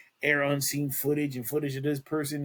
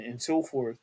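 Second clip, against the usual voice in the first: normal talking voice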